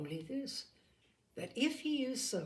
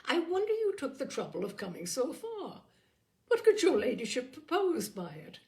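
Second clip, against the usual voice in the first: disdain; 5 semitones higher, 5 dB louder than usual. A narrator's reading. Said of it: "colouring with astonishment and disdain".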